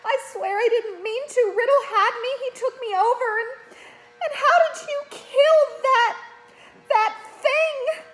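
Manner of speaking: put-on high, loud tearful girl's voice; a frightened young girl crying in relief; Ginny sobbing. A narrator's reading. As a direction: blurt it all out through sobs.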